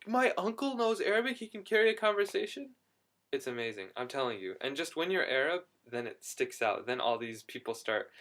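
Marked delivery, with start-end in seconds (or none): 0.1-2.7 high, loud astonished question, retelling his friend; stunned disbelief. Retold or imitated. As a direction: pitch it way up in amazed disbelief. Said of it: "My friend is like".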